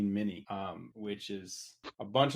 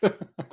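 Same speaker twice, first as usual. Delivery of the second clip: short laugh; 11 semitones higher, 7 dB louder than usual. Real, not acted. Laughs at own endorsement.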